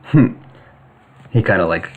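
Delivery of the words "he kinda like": ordinary delivery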